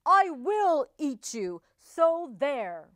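loud, emphatic, huffy roar; roared threat. A narrator's reading. Offended wolf threatening number five, huffy roar. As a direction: throw the threat out loudly. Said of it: "he roared".

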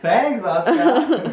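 slow, high, laughing sarcastic thanks. Acted. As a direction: drag it out mockingly.

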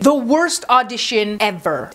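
slow dramatic. Real, not acted. Dramatic buildup before the story.

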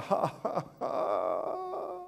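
long, wailing mock sob; mock crying. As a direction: imitate a woman bawling. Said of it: "she started to cry".

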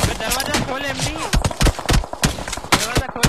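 yelling open the door; loud, urgent shout at teammate. Real, not acted.

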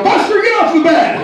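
high-pitched shout at the dog; retold yell. Wife shouting at Buster the dog from across the room, high yell. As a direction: yank the dog off the bed with a sharp high call. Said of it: "heard her running and yell".

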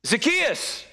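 Zacchaeus called out high, drawn out and falling; sung-out call from below; calling up to him. Acted. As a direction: call his name up into the tree. Jesus calls up into tree.

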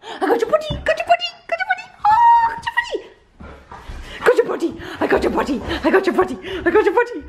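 high, squeaky play voice; silly high-pitched chanting at a pet; playful pet talk. Pretend. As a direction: tease the pet in an excited squeal. Playing excitedly with the pet.

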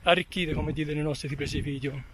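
ordinary delivery

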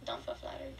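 hushed aside; whispering. Real, not acted. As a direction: whisper it behind a hand.

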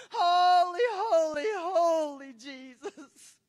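drawn-out cry of worship, starting loud and falling; tearful praise. Real, not acted. Preacher crying out to Jesus, tearful praise. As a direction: overcome preacher calling out through tears.

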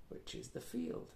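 breathy whisper to the listeners; hushed explanatory aside. A narrator's reading. Aside explaining word to children.

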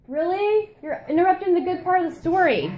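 high, exasperated complaint; exasperated scolding. Real, not acted. Sister scolding Jared mid-story, exasperated.

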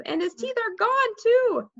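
high, urgent exchange of shock; alarmed. A narrator's reading. Boys discover Fudge's teeth are missing, alarmed. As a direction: push the pitch up in disbelief.

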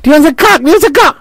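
very high-pitched insistent Newcastle server; Newcastle accent. Retold or imitated. Counter lady pressing a baffled customer, Newcastle accent. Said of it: "she keeps looking at me like".

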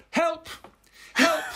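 high-pitched imitation of a toddler's cry for help; mock child's shout. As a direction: yelp it very high, hamming it up. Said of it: "shouted at the top of his voice".

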